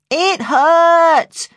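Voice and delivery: put-on very high, loud drawn-out child's wail. A narrator's reading. Jodie insisting to her carer that she's in pain, loud high wail. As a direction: wail it in pain, stretching the last word. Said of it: "She was getting louder".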